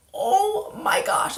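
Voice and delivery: high, slow, stunned "oh my gosh"; drawn-out disbelief after the fall; shocked gasp. Retold or imitated. Retelling her shock after the bus fall, stunned and drawn out.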